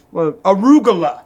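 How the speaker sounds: imitated bad accent on "arugula"; Vinny's bad accent. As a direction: put on a comically bad movie accent. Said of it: "the bad accent is what I love about Vinny".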